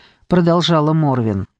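usual speaking voice

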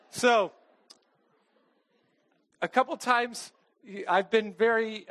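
ordinary talk, nothing marked

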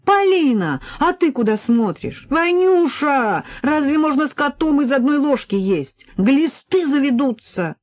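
high-pitched, indignant alarm; scolding. A narrator's reading. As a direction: call them out, appalled. Mother scolding after catching toddler. (возмущённо, с ужасом)

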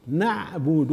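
"نعبد" with a clear thin "na"; light nun. Shown as a demonstration. Teacher repeating the fix, thinned nun. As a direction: open "نعبد" on a front a, no rounding. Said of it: "ترقيقها".

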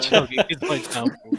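loud laugh through the line. Real, not acted. Reading the silly sentence to friends, laughing loudly. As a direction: crack up reading it out.

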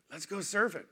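tempting buddy. Retold or imitated. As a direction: lure him out to the waves. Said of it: "buddy tempting him".

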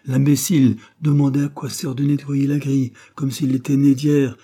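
loud, high exasperation; scornful outburst. A narrator's reading. Wife mocking husband's grate question, loud scorn. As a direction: heap scorn on his question, loudly.

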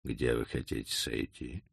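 low, hushed question; quiet question. A narrator's reading. Doctor quietly asking Hoffmann in the carriage. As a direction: ask it under his breath. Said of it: "он тихо спросил".

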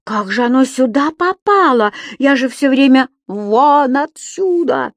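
high, loud, slowing cry; alarmed shout. Acted. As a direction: blurt it out in panic. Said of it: "закричал господин Дауме".

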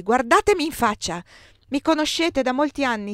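stamping-foot indignation; angry insistence. A narrator's reading. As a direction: insist angrily on being recognised. Angry woman confronts the gendarme.

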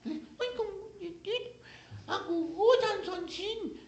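tiny high falsetto cooing; mock doting lover in a squeaky little voice; coaxing baby talk. Dalila babies Sansón to sleep.

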